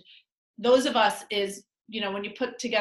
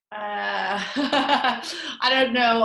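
laughing; 2 semitones higher, 7 dB louder, slower than usual. Real, not acted. Laughing about new skills.

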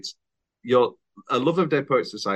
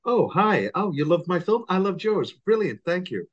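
higher, gushing party luvvie; fake gushing actor; 8 semitones higher than usual. Mocking actors' party chat, fake gushing delivery. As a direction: gush like a phony actor at a party. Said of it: "great Steve Martin joke".

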